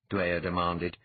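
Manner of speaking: ordinary delivery, nothing marked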